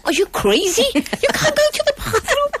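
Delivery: high squealing disbelief, half laughing; retold panicked outburst. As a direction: shriek it up high, laughing and wailing at once. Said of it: "I said".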